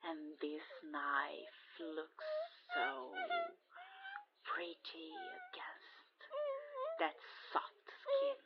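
high, soft menacing lilt; creepy singsong. Acted. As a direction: coo it sweetly over the knife. Admiring knife on skin.

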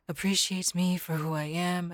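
usual speaking voice

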